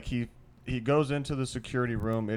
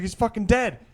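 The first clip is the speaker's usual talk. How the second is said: higher, louder frantic cry; frantic report; 10 semitones higher, 7 dB louder than usual. Retold or imitated. Guy insists to the girls, loud frantic panic. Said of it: "he's like".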